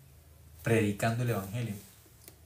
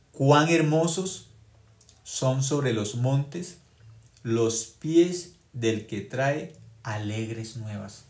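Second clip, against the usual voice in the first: very slow, drawn-out verse reading; slow, reverent Bible reading; solemn scripture reading; 4 dB louder, much slower than usual. Acted. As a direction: read the verse slowly and solemnly.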